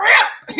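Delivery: loud, high rolled "rrrip"; imitated ripping sound. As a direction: roll the R hard like fabric tearing. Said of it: "That shit gonna go".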